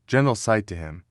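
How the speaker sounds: ordinary delivery, nothing marked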